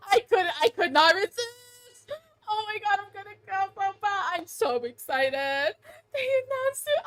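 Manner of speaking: high, overwhelmed happy wail; tearful fangirl joy; about to cry. Real, not acted. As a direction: wail it, nearly crying with excitement. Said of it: "I literally was gonna cry".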